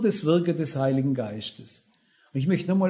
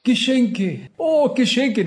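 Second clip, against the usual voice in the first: mock delighted little girl shouting, higher and louder; excited child's cry; 6 semitones higher, 3 dB louder, slower than usual. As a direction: imitate a granddaughter squealing over presents. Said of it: "dann kam immer ein Schrei".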